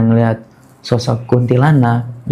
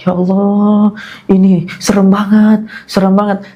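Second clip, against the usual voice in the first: higher, whining plea of terror; imitated tearful wail; 5 semitones higher than usual. Crying while riding away, imitated tearful wail. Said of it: "Itu gua sambil nangis-nangis".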